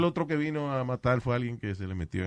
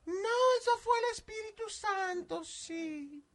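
high falsetto; 7 dB quieter, slower than usual. Retold or imitated. Retelling a voice as Holy Spirit.

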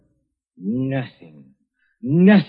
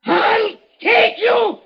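crazed shouting threat; 14 semitones higher than usual. Acted. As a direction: scream it like a madman dragging them down. Dying man threatens to take everyone.

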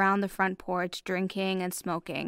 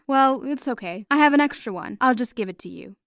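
ordinary delivery

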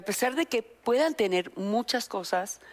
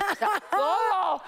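excited emphasis; 10 semitones higher, slower than usual. Real, not acted. Remata la burla riendo.

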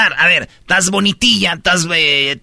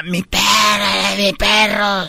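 mock yell; much slower than usual. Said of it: "donde gritaba".

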